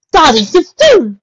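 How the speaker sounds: loud, high, drawn-out distressed cry; whimpering. A narrator's reading. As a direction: wail it slowly in distress. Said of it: "whimpered Rarity".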